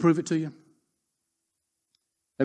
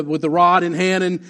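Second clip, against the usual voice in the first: ordinary delivery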